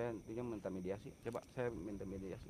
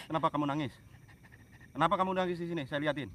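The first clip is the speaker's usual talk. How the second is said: higher and louder, demanding; loud questioning; 7 semitones higher, 10 dB louder than usual. Real, not acted. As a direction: call out to the spirit to answer. Questioning a spirit at a tree. (bertanya lantang dan tinggi)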